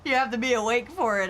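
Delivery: normal talking voice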